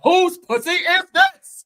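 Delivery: loud, slow, put-on commanding voice; James Earl Jones. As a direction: stretch it out with mock grandeur. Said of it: "you gotta have that voice".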